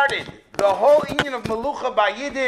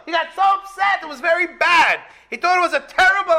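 emphatic; 7 semitones higher, 3 dB louder, faster than usual. Real, not acted. Teacher stresses Shmuel's anger.

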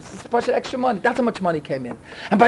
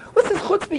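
mock yelling; 10 semitones higher than usual. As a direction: retell an angry caller with pitch but no volume. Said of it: "They were yelling at me".

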